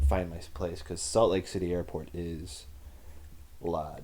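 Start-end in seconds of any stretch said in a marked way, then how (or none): 3.6-4.0 put-on dropped R in a Boston accent. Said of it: "Boston accent again".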